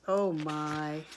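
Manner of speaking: ordinary delivery, nothing marked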